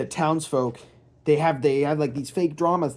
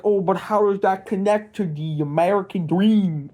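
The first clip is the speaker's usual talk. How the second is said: imagined viewer's silly, louder, pitched-up whine of a question; mocking viewer voice; 5 semitones higher, 4 dB louder than usual. Imagined viewer questioning the vlogger, in a silly mocking voice. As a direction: put on a silly voice for the objection. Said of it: "why are you talking like that?".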